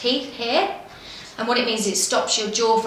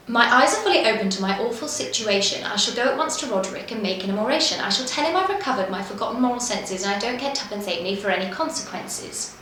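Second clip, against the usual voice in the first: fast, crisp patter with every consonant sharp; rapid patter demo; faster than usual. Shown as a demonstration. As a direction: rattle it off fast but keep each word clear. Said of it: "So it goes something like this".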